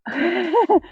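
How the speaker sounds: short high giggle; amused laugh. Real, not acted. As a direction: break into a quick laugh. Reader laughs at repeated line.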